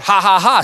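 loud, high fake devil laugh; mocking laugh. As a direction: jeer with a spelled-out ha-ha-ha. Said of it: "he's going".

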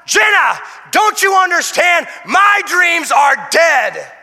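high, loud anguished yell; desperate yell. Retold or imitated. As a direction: shout it slowly. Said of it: "I was yelling out".

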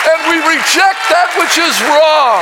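loud, rising preacher's cry; rally speech. Acted. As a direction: preach it at full force. Preaching at full force to the congregation.

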